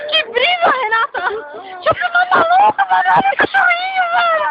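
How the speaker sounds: high and excited, stoned giggle; babbling high, stoned and amazed; chapado. Real, not acted. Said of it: "Me tirando essa brisa!". (voz de chapado, empolgada e aguda)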